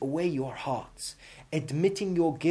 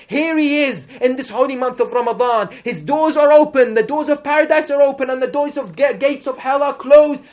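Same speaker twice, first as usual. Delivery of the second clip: raised, ringing call to the faithful; fervent preaching; 7 semitones higher, 10 dB louder than usual. Acted. Preacher calling his congregation toward open doors, fervently. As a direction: lift the voice and urge them on. Preacher urging during Ramadan.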